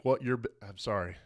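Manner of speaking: ordinary delivery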